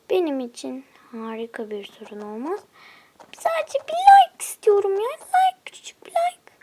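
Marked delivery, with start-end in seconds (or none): 3.3-6.5 high whispered mock whimper; whiny plea. To viewers pleading for one like, mock sob whisper. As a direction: beg for one tiny like like a tearful child.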